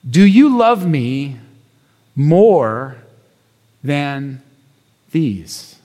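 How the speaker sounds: gentle, searching delivery; slow solemn question. Acted. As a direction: let the question hang, falling low on these. Slow solemn quote of Jesus.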